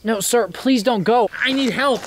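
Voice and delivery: high, imploring cry for help; desperate plea. Acted. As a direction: plead with them to stay. Begs the man not to leave.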